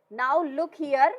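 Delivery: slow, loud and stern. Acted. Bill getting tough with the mother, a loud stern warning. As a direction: lay down the law, slowly. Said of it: "अब और तेज तेज बोल रहा है".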